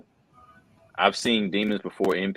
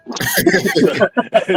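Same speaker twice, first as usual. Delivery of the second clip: loud, high burst of laughter; laughing; 9 dB louder than usual. Real, not acted. Joking about dying on the phone, laughing. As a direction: break up in a high, loud cackle.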